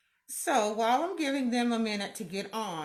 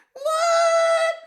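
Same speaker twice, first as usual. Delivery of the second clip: surprised squeal; 11 dB louder than usual. Real, not acted. Surprised shriek at greeting.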